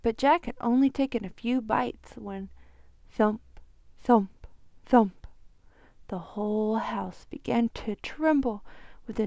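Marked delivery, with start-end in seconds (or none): 3.1-5.3 loud heavy thump sounds; booming thumps. Acted. Giant's footsteps shaking the house, booming thumps.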